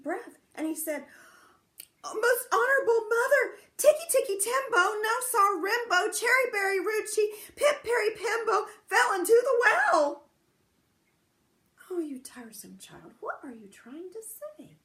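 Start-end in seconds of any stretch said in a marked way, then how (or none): 2.0-10.2 put-on very high, loud crying shout of a little boy; desperate child's shout. Chang shouting louder to mother. 11.9-14.8 impatient whispered mother's question. Acted. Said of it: "asked his mother".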